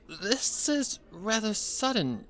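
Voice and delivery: put-on high, slow startled girl's voice; sudden surprise, pitched high and slowed; surprised. A narrator's reading. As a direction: caught off guard, voice jumping up. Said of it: "surprised her sister would bring it up".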